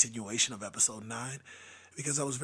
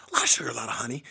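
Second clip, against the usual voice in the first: hoarse whisper; 7 dB louder, much faster than usual. Real, not acted. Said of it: "this is what I sound like now".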